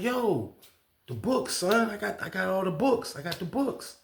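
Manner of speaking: high-pitched insistent sales pitch; imitated hustler. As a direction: push your goods like an eager prison hustler. Acts the inmate pitching his books.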